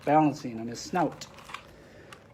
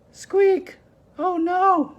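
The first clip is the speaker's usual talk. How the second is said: very high, louder mouse cry of alarm; squeaky mouse yell; 5 dB louder, much slower than usual. Acted. Mister Mouse seeing the broken tusk, high squeaky yell. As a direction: shriek like a startled little mouse. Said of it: "Mister Mouse yelled".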